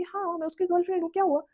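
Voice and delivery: cocky attitude. Retold or imitated. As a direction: puff yourself up and claim him proudly. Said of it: "मैं अभी चौड़ी होके बोल रही हूँ".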